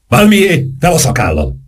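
high exclamation, falling at the end; a mild narrated cry of command; shouted order. A narrator's reading. Saint-Germain orders Valmier to put the beard on, calling out. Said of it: "kiáltotta".

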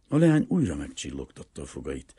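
usual speaking voice

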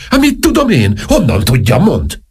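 high, agitated retort; exasperated. Acted. King has no idea what the crowd wants, high and exasperated. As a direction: voice leaping up in frustration.

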